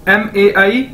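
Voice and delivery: ordinary talk, nothing marked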